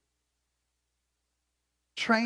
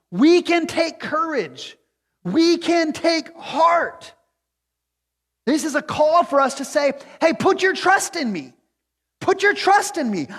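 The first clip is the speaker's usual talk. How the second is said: loud, high, fervent preaching at full force; full-voiced pleading sermon climax; impassioned preaching; 10 semitones higher, 7 dB louder than usual. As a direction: pour it out like a revival preacher, building to a cry.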